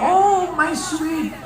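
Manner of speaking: slow, soft, high mock endearment; a hammed-up widow cooing. Preacher mocks a widow who neglected her husband's grave, cooing.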